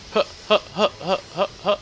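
high breathy villain huh huh huh; hammed-up sinister laugh; mock evil chuckle. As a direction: cackle up high like a cartoon baddie.

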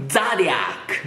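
ザディアック with an open, spread "zah"; flat American pronunciation. Shown as a demonstration. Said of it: "ベタっとした英語になるんですよね". (ベタっとしたアメリカ発音の「ザディアック」)

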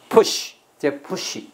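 teacher drilling Push word by word; slow demo. Shown as a demonstration. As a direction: Push said slowly and carefully, then 这push. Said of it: "安得好啊".

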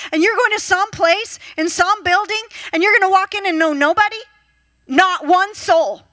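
high, loud, driving sermon voice; fervent preaching. Acted. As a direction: preach it with full fire. Preaching at full force.

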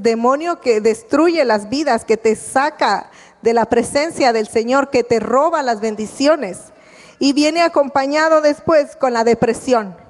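ordinary delivery

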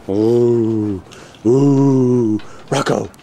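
ordinary talk

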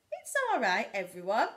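high, loud reassurance; Percy calling the hidden animals. Acted. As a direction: call everyone out.

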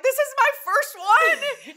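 laughing squeal. Retold or imitated. Admitting to her friends it's only her first glass, laughing squeal.

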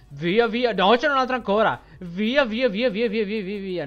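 frantic, high urgent run calls; being chased. Real, not acted. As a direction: flee in panic. Fleeing the ants.

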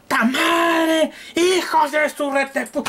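high, slow outraged cursing; fake fury. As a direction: blow up at the dead laser in mock rage. Mock rage at broken laser. (maldición furiosa y aguda, actuada)

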